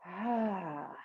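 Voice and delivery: frustrated sigh, slowed down. Shown as a demonstration. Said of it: "let it out through your mouth a little slower".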